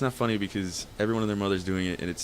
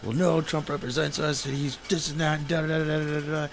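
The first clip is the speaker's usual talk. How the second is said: higher-pitched mock rant, dragged out; imitated rant; 6 semitones higher than usual. Brother going off about Trump, imitated rant. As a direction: run through his tirade, trailing into filler. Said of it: "he went off".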